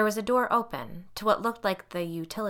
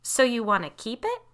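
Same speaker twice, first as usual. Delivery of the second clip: forced light tone; 5 semitones higher, 6 dB louder than usual. A narrator's reading. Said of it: "my tone still forcibly light".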